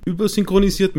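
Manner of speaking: usual speaking voice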